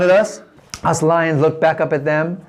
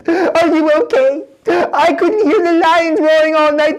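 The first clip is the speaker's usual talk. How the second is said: a fretful king leaning over the den's edge; worried king; 9 semitones higher than usual. Acted. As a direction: call down into the pit fearing the worst. King calls down into the den.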